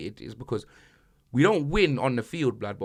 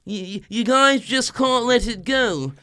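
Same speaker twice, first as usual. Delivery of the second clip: Sol Campbell impression; 5 semitones higher than usual. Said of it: "and then he's like this".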